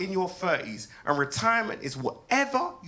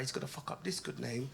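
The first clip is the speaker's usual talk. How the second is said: much softer muttered prediction; muttered aside; 4 semitones lower, 12 dB quieter than usual. Retold or imitated. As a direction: say it under your breath to yourself. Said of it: "I'm like".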